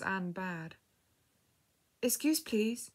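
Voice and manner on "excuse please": timid voice, pitched higher; hesitant, slightly frightened request; scared and timid. A narrator's reading. Young girl calling from behind the curtain, timidly. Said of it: "sounded scared and timid".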